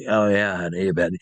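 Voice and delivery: imitating a low-voiced old barfly; old bar lady. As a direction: play the seasoned cigarette-and-barstool lady. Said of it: "one of those old bar ladies".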